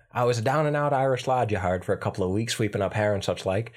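put-on stage Irish lad; down-and-out Irish accent. Shown as a demonstration. Lad hired to sweep up hair, Irish accent. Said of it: "I can't do that one as easy".

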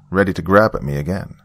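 plain reading voice

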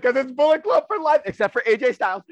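high, loud, laughing through the quote; cracking up while imitating him; laughing mock boast. Friend retelling a wrestler's boast, laughing.

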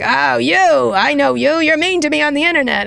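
Scaramucci impression. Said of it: "and he's like".

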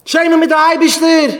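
acted outrage at the top of the range; outraged cry. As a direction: throw it back as an indignant high-pitched cry. Acted outraged retort, continued.

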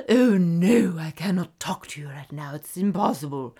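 hammed-up drama with a loud opening wail; melodramatic. Shown as a demonstration. Host playfully refusing a phone call, melodramatic. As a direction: overplay it like a stage tragedy. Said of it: "play with the drama of".

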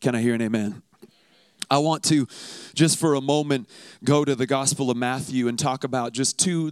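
normal talking voice

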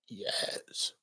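breathy whispered yes; whispered yes to the neighbor. Retold or imitated. Proudly answering the neighbor.

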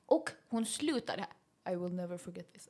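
ordinary delivery

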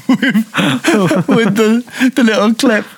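high, giggly and broken up; laughing through words. Real, not acted. Joking to co-host that this is the stream clip, laughing. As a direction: struggle to speak through real laughter. Still laughing at the failed accent.

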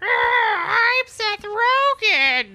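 high, slow cackling witch voice; evil old witch. Pretend. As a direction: croak it like a wicked old witch. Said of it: "I sound like an evil old witch".

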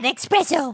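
espresso said as "ex-presso"; mispronounced espresso. Acted. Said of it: "No, you said espresso".

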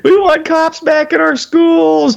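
high, slow mock chant; mocking protesters. Mimicking parents who demand police back in schools, high and slow. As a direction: parrot their demand in a high, drawn-out whine. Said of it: "They're screaming for their S R Os back".

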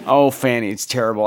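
normal talking voice

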